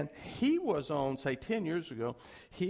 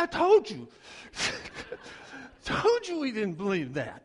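higher, louder gotcha with a laugh; triumphant; 7 semitones higher, 6 dB louder than usual. Retold or imitated. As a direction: crow over being right. Pastor triumphantly proved right.

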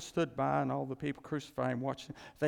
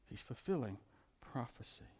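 whisper; 7 dB quieter than usual. Real, not acted. Hushed emphasis.

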